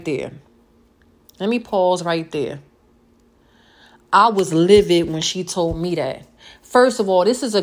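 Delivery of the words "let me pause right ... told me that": ordinary delivery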